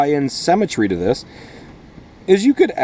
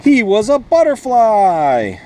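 much higher, slow and bright with wonder; excited reveal; 9 semitones higher, 3 dB louder, slower than usual. Acted. Teacher revealing the butterfly to young listeners, thrilled and slow. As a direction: unveil the surprise to wide-eyed kids. Big reveal at story's climax.

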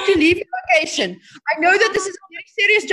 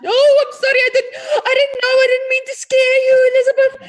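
startled apology; 4 semitones higher than usual. Acted. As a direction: blurt out a shocked sorry. Medium startled by angry spirit.

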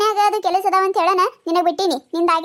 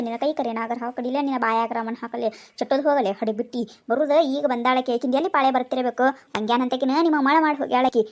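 put-on cursing old woman; 7 semitones lower than usual. An old woman at the tap curses the younger woman in a much lower voice.

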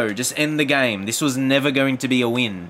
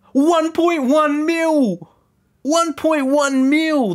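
much higher, slow, drawn-out sing-song of amazement; amazed; 4 dB louder, much slower than usual. Real, not acted. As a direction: stretch out the number in delighted disbelief. Marvelling at player's value.

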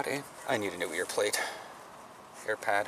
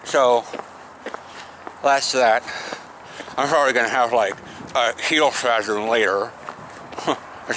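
talking around mouthguard; mouthguard in mouth. Real, not acted. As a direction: teeth clenched on guard. Seen 0: Green mouthguard clenched between teeth throughout; inserted around -5s.

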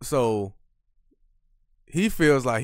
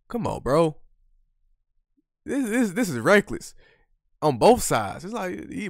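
normal talking voice

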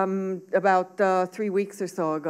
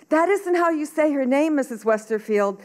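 higher-pitched pupil piping up; correcting schoolboy; 6 semitones higher, 3 dB louder than usual. A narrator's reading. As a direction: correct the teacher in a child's higher voice.

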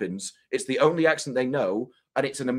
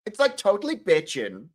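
Valley girl impression; 6 semitones higher than usual. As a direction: have a go at Valley girl slang on request. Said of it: "can you say".

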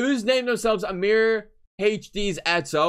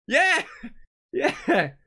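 excited yell; 7 semitones higher than usual. Real, not acted. Teasing chat back.

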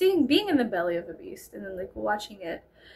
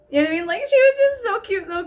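normal talking voice

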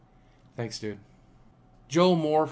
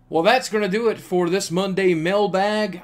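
loud, higher-pitched wrap-up announcement; big sign-off; 7 semitones higher, 8 dB louder than usual. Real, not acted. Vlogger wrapping up the Monday mailbag, loud sign-off. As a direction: belt out the closing line, stretching the last words.

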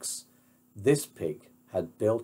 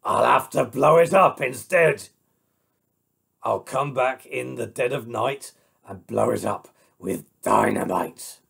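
gruff, menacing wolf voice; scheming wolf; 5 dB louder than usual. Acted. Wolf vows to blow the house up, gruff and menacing. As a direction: threaten darkly as the big bad wolf.

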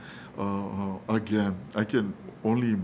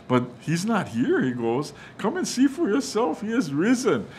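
much higher, thrilled proclamation; excited angel; 9 semitones higher, 3 dB louder than usual. Retold or imitated. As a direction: burst out with joyful news. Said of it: "as excited or as calm as we can stay".

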